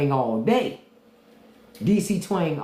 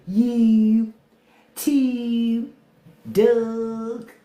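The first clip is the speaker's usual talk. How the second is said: higher-pitched Baltimore vowels, each word held; Baltimore accent; 8 semitones higher, 4 dB louder, much slower than usual. Shown as a demonstration. Mimicking how Baltimore says words, exaggerated Baltimore accent. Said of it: "Baltimore too".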